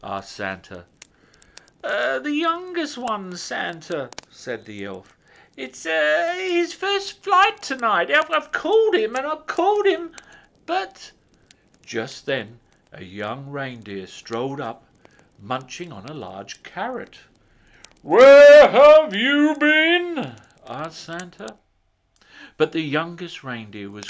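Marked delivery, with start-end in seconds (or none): 1.8-4.1 high, loud hesitant elf voice; little old elf. A narrator's reading. Elf hedging his answer to Santa, high and hesitant. 5.6-11.1 very high, quick flustered elf voice; fretting elf. A narrator's reading. Elf explaining to Santa, high and flustered. 18.0-20.3 high, loud, very slow question; booming Santa. A narrator's reading. Santa greeting the late reindeer, loud and slow.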